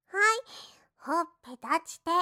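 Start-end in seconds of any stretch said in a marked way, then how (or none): 0.1-0.4 sharp call. Acted. Scolding into punishment.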